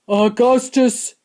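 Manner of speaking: loud, slow call; father's cry. A narrator's reading. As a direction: call your son firmly and loudly. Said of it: "cried Mister Gloop".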